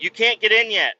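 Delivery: a retold shout from the boat; mock yell. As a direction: bark it like a worried in-law. In-laws yelling from the boat.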